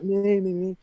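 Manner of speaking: put-on a child desperate to be chosen; eager kid.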